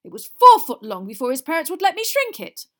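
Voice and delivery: loud, high, exasperated shout; outraged. A narrator's reading. Father answers his son about the tongue, outraged.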